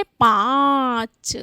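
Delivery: पाँच with very long nasal आँ, separate च; nasalised vowel demo. Shown as a demonstration. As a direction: stretch the vowel of पाँच through mouth and nose. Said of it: "इसको कैसे उच्चारित करेंगे?".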